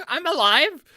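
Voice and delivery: high, loud, laughing it out; loud excited retake. Real, not acted. Streamer repeats the joke line to chat, loud and high. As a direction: blurt it with a grin.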